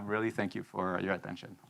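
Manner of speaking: normal talking voice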